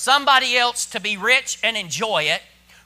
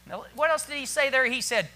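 ordinary talk

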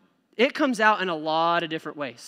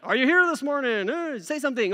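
higher, mock pastor prodding the crowd; imitating the pastor; 7 semitones higher than usual. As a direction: lightly ham up the pastor coaxing a reply. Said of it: "the pastor's always like".

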